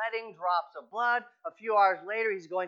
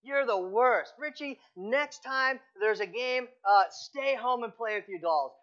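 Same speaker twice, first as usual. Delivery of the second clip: put-on higher, loud jeering kids calling after him; mock the boy like angry teammates leaving; taunting teammates; 6 semitones higher, 4 dB louder than usual. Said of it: "they're calling back to him and they said".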